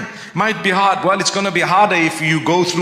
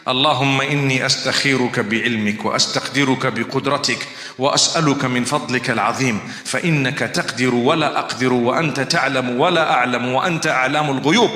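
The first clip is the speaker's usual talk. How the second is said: rapid, lower-pitched Arabic supplication; very fast recitation; 5 semitones lower, much faster than usual. Shown as a demonstration. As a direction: rattle the dua off from memory at double speed. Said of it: "I know I said it very fast".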